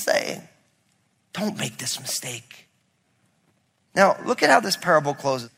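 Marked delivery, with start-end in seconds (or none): 1.3-2.7 hushed, urgent whisper of warning. Acted. Preacher warns the congregation in a whisper. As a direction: lean in and whisper it.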